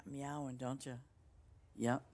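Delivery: usual speaking voice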